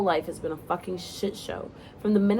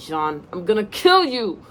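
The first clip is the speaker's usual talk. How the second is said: playful threat; 4 semitones higher, 8 dB louder, slower than usual. Acted. Character snapping into headset.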